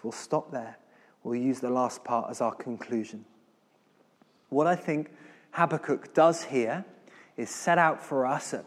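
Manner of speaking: ordinary delivery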